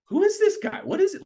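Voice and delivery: way up high, baffled inner voice; incredulous. Retold or imitated. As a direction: toss the question up in pure disbelief. Puzzled by a stranger's routine.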